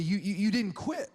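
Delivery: usual speaking voice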